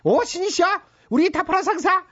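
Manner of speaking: mock prayer. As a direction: beg the heavens like a pompous preacher. Boss mock prays for sales. (과장된 가짜 기도조)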